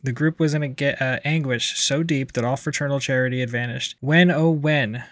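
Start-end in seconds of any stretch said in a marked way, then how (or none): 4.0-5.0 slow, drawn-out groan of exasperation. A narrator's reading. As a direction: stretch it out like weary complaint. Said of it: "groaned members of one another".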